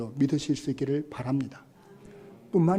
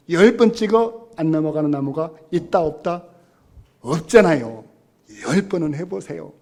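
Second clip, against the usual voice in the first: rousing call; 8 dB louder than usual. Real, not acted. Preacher rousing congregation.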